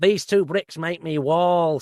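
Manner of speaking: lightly voiced Manchester punk poet, slow. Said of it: "a sort of [name]".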